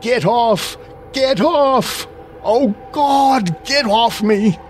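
very high, panicked cry of terror; blood-curdling cry. A narrator's reading. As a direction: cry out desperately, pitched far up. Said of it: "ended in a blood-curdling cry of".

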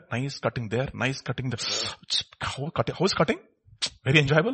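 usual speaking voice

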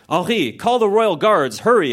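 high, rising alarm, acted; nervous exclamation. A narrator's reading. Wife tells husband to call guards, nervously exclaiming. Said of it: "exclaimed Leslie nervously".

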